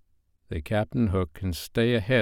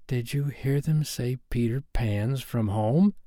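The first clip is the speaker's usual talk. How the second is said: Smee whispering; 3 semitones higher, 4 dB quieter than usual. A narrator's reading. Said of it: "Smee whispered".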